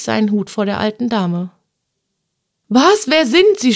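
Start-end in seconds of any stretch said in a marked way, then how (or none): 2.7-3.7 high, loud, piercing demand; startled shriek, sharply questioning; shrill cry. Acted. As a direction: cry out in shrill shock at a stranger. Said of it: "schrillte es gellend".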